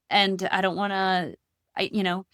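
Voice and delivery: ordinary talk, nothing marked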